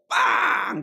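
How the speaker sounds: loud drawn-out honk noise; sudden high honking shout; imitated car horn. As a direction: blare it like an impatient horn.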